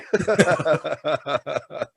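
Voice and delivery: long cackle, falling away; imitated sadistic cackling; gleeful Chucky laugh. Chucky laughing as his son attacks him, gleeful cackle. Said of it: "and he's laughing".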